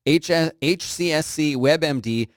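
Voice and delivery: normal talking voice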